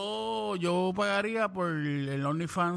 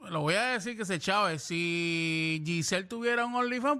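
ordinary delivery

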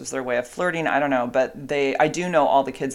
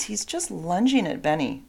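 scolding the dog; 8 semitones higher, 3 dB quieter, slower than usual. Real, not acted. Scolding dog mid-recording.

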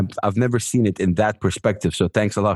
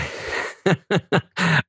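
laughing; 9 semitones higher than usual. Real, not acted. Laughs at friend's praise.

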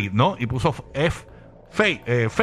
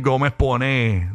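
ordinary talk

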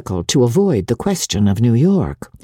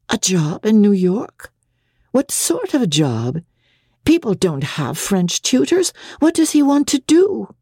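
wife, more and more surprised at her husband; growing surprise; 6 semitones higher than usual. A narrator's reading. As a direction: build from puzzled to astonished. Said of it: "Her surprise increased".

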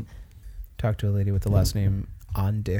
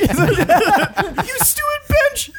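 very high, slow squeaky insult; silly high voice; 5 dB louder, much slower than usual. Pretend. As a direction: squeal it out slowly.

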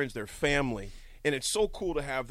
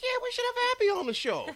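much higher-pitched enthusiasm; eager retold agreement. Host retells agreeing to wife's idea, eager high voice.

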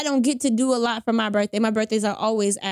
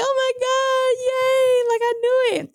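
high-pitched re-enacted squeal of joy; acting out overjoyed excitement; crying happy; 14 semitones higher, 3 dB louder, slower than usual. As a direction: squeal with delight, nearly in tears. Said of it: "I'm crying and I'm happy".

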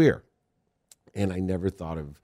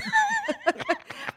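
high-pitched burst of laughter. Real, not acted. Guest cracking up after teasing, high laugh.

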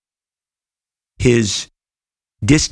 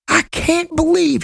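slow, much higher mock Southern drawl imitating Dave; Southern twang; slower than usual. Dave angrily phoning Rob about Giffen, imitated in a Southern twang. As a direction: stretch it out in a put-on Southern accent, imitating an angry caller. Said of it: "he had a little bit of a Southern twang".

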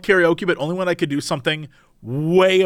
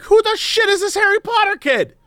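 much higher, whiny complaining; indignant high whiny voice; 14 semitones higher than usual. Pretend. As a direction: whine about the new kid. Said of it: "day one, I'd be like".

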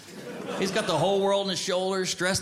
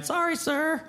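put-on meek kid; 10 semitones higher, slower than usual. Kid answering adult.

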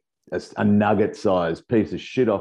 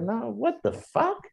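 much higher, slow mock-shocked denial; feigned innocence; slower than usual. Denying the sugar jar prank to the roommates, high slow mock-shocked denial. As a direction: act falsely innocent and shocked. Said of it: "I was like".

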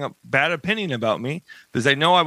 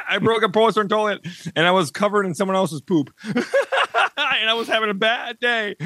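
laughing; 6 semitones higher than usual. Real, not acted. Recounting embarrassing mishap, laughing.

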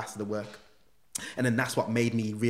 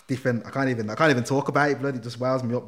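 usual speaking voice

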